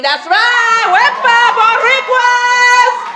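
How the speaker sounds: high, loud Puerto Rican parade yell; proud whooping cheer. Acted. Puerto Rican to the crowd, jubilant high cheer. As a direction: holler it and stretch the last word long.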